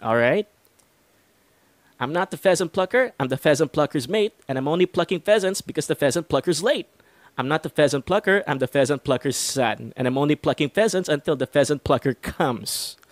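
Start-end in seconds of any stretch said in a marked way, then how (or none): 2.0-13.0 the twister rattled off at a quicker clip; faster tongue twister. Shown as a demonstration. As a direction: pick up the speed and run the lines together. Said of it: "[name]'s gonna go faster".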